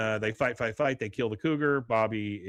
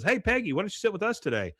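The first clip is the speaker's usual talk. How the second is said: high, quick imitation of an inviting teacher; friendly invitation; 9 semitones higher, faster than usual. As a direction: call her over in a high, put-on voice. Teacher invites Peggy to popular table.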